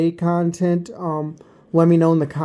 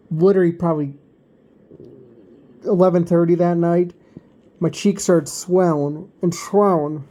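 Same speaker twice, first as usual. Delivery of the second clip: normal talking voice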